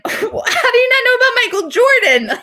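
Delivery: high, squealed disbelief through laughter; laughing, high-pitched mock outrage; incredulous outburst. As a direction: act out exaggerated disbelief, voice shooting way up. Mock outrage at his ignorance.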